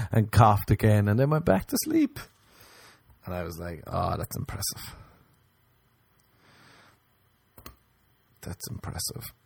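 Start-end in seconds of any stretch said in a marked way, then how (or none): 3.9-9.3 whispering. Retold or imitated. Whispered awe.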